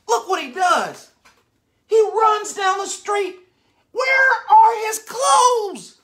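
high, loud amazed exclaims; excited shouting. Real, not acted. Excited storyteller reacting.

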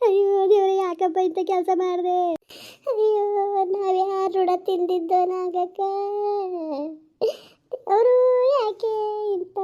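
high, sobbing lament; keen and wail to God; crying lament. Acted. Woman lamenting to her friend Nagakka, high sobbing wail.

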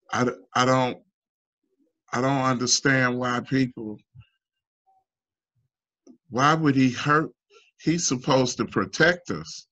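mildly upset child's plea, retold; retold crying. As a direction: voice a hurt, bewildered daughter without full sobs. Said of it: "She's crying".

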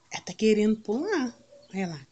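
usual speaking voice

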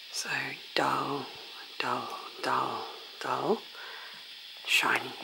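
whispering softly up close; ASMR whisper. Real, not acted. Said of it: "so I have to do my A S M R voice".

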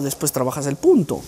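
ordinary delivery

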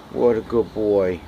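ordinary delivery

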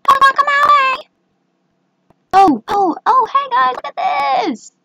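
way up high, excited squeal; squeaky high excited calling; high-pitched voice. Real, not acted. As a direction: talk in a very high voice. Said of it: "if I talk in a higher voice".

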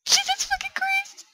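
high, thin, slow squeal; scared yelp held back; startled squeal. Real, not acted. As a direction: squeal it out in fright, high and soft. Startled while playing horror game.

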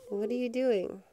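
ordinary delivery

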